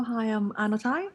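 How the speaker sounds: ordinary talk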